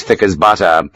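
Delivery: ordinary delivery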